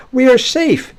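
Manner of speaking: very high, loud exclamation; mock triumphant claim. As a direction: crow it as smug worshipers would. Voicing the people's presumptuous claim.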